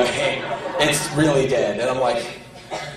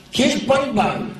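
high, slow, sing-song lady voice; crazy lady voice; 10 semitones higher, slower than usual. Retold or imitated. Comic mimicking the crazy woman at the pet shop. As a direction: mimic the nutty rabbit owner sweetly. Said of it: "this woman talked like this".

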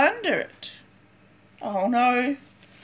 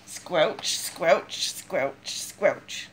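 low squelchy sounds; 6 semitones lower, 5 dB quieter, much slower than usual. Acted.

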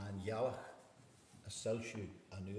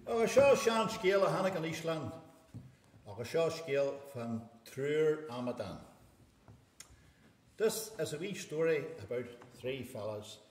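Irish storyteller voice, louder and bright; lilting Irish storyteller; 4 semitones higher, 5 dB louder than usual. Acted. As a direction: open the tale like a seanchaí. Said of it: "Is mise an Shanachie".

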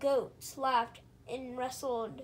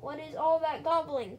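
loud annoyed call from bed; farmer's cry; 3 semitones higher, 7 dB louder than usual. Acted. Farmer woken in bed, loud annoyed cry. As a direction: shout it from bed, irritated. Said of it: "cried the farmer".

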